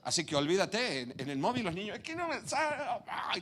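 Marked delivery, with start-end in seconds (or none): 2.0-3.4 put-on high whining kid complaint; whiny child. Preacher imitates a child fussing at a phone. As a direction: whimper like a kid losing a game.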